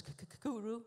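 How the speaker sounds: "k-k-kuru" with the k repeated twice before "kuru"; imitated stutter. Shown as a demonstration. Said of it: "carefully noting that this particular word was tsuru".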